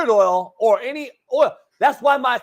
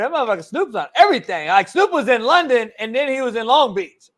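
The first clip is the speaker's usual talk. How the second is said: ordinary talk, nothing marked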